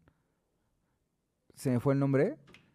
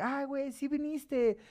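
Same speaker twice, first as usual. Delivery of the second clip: mock excited party guest; 11 semitones higher, much slower than usual. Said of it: "Decía".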